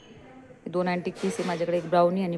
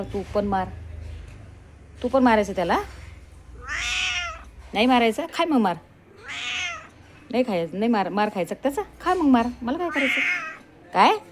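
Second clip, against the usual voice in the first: loud, higher exasperated telling-off; mock exasperated scolding; 7 semitones higher, 5 dB louder than usual. Real, not acted. Owner loudly scolding her dog to fight back. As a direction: throw up your hands at the dog, loudly.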